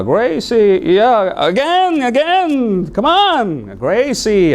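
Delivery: high, cheery coaxing drill; eager teacher. Retold or imitated. Teacher urging the class to repeat, high and cheery. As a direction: coax the class through the drill.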